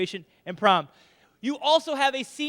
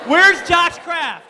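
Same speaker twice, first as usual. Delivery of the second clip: loud, slow, higher call; 7 semitones higher, 9 dB louder, much slower than usual. Real, not acted. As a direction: shout across the gym. Calling out a student.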